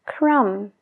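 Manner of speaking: "crumb" said as crum, no b; silent b in crumb. Shown as a demonstration. Teacher models "crumb" with the silent b. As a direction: end "crumb" on the m. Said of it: "la B no se pronuncia".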